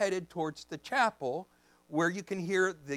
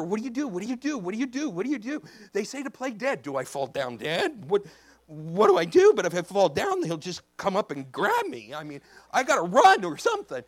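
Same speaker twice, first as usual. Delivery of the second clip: hurried, raised, mock-panicked questioning; panicked thinking; 2 semitones higher, 7 dB louder than usual. Man facing a bear wonders what to do, mock panic. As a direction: act out a frantic mental scramble. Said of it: "you're panicked".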